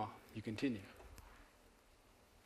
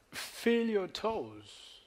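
slow guided reflection; 7 semitones higher, much slower than usual. Acted. Guiding audience reflection.

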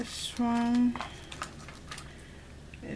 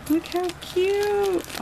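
cooing over cute; 9 semitones higher, 3 dB louder, much slower than usual. Real, not acted. Gushing over tiny nail rings.

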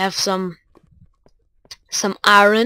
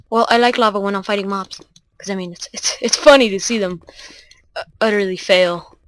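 normal talking voice

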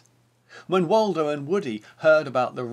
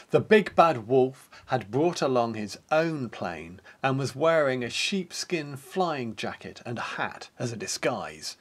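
ordinary delivery

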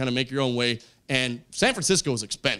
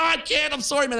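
high-pitched, hammed-up apology; mock panic; 10 semitones higher than usual. Comic pulling away from a kiss, mock panicked. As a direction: back out in an acted high panic.

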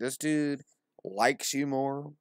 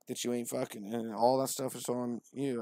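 normal talking voice

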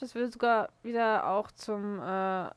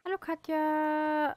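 sad greeting; 5 semitones higher, slower than usual. Acted. Vertont Josh beim Begrüßen.

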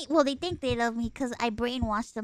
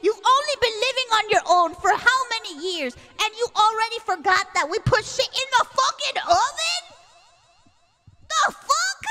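loud, raised-pitch retold tirade; incredulous ranting; 5 semitones higher, 4 dB louder than usual. Sibling to sister who forgot family habits, incredulous rant. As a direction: rant on and on, building to a higher pitch.

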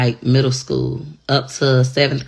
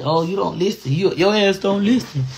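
husband imitation; 4 semitones higher than usual. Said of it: "My husband always tell me".

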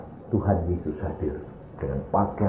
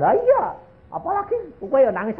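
high-pitched, hurried quote; mock flustered retort. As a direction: blurt the admission in a comically high voice. Retold exchange between weeping companions. (menirukan jawaban heboh bernada tinggi)

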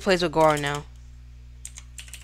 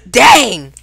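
loud exclamation; 8 dB louder than usual. Real, not acted. Reacts to the fight.

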